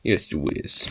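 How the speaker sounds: soft whisper to the dog; hushed cooing whisper. Real, not acted. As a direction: whisper it close to the puppy.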